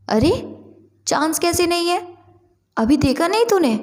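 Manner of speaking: high, insistent questioning; protesting disbelief. A narrator's reading. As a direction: argue back at a friend in disbelief. Juhi protests to her friend.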